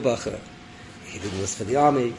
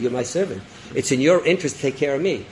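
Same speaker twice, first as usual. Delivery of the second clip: usual speaking voice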